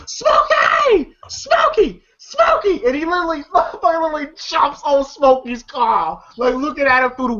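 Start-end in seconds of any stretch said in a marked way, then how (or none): 0.1-2.8 very high-pitched repeated cry of a name; imitated calling out. Retelling a character chasing down his friend, high imitated calling. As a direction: call the name out high, over and over. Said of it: "You see Smokey goes".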